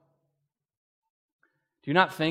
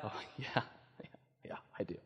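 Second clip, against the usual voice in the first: laughing whisper; 5 semitones lower, 17 dB quieter than usual. Retold or imitated.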